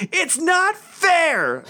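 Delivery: put-on high, drawn-out child's yell; daughter's yell. Daughter yelling down the stairs at dad, outraged yell. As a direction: imitate a bratty screamed protest. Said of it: "she yells back down to me".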